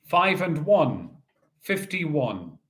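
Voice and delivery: slow, drawn-out number calling; bingo caller. Acted. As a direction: call each ball out steadily.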